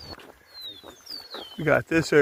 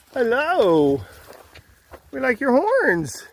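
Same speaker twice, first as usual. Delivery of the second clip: put-on baby talk to cows; 7 semitones higher, slower than usual. Cooing at cows while passing.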